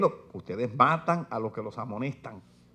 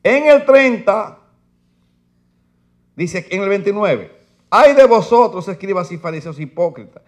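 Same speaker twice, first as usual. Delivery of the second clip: fiery preaching; 5 semitones higher, 13 dB louder than usual. Acted. Preacher reading woes to his church, fiery and loud. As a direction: thunder the verses at the congregation. Preaching the text at full force.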